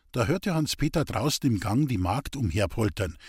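ordinary delivery

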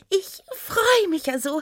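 high, delighted squeal of joy; excited gnome. Acted. Gnome to her new partner, excited and high. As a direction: bubble over with high excitement. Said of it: "Aufgeregt hüpfte Oda auf und ab".

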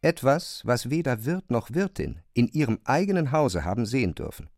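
usual speaking voice

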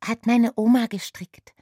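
put-on high, loud eager child; proud little girl voice. A narrator's reading. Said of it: "Das Mädchen nickte eifrig". (eifriges kleines Mädchen)

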